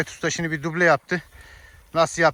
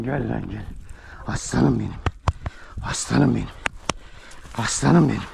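cooing to a dog; 8 semitones lower, 4 dB quieter than usual. Real, not acted.

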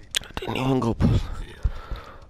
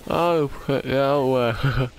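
normal talking voice

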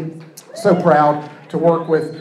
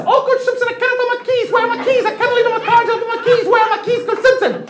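very high, frantic falsetto plea; Zambian mom impression. Mother bursting into the coach's office, high frantic falsetto. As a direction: squeak it out, panicked about the keys. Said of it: "what I heard in my brain".

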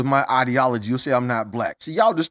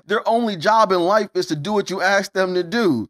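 usual speaking voice

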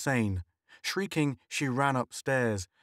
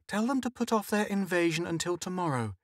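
nervous joke; 7 semitones higher than usual. A narrator's reading.

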